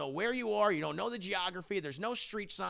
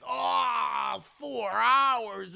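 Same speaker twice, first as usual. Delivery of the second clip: higher, louder, groaning whine; whiny complaint; 5 semitones higher, 4 dB louder, much slower than usual. Retold or imitated. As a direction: moan about the long drive. Mark complains about the drive.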